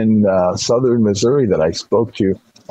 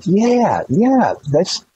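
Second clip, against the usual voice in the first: eager yes; 9 semitones higher, much slower than usual. Retold or imitated. Speaker eagerly confirms to old lady.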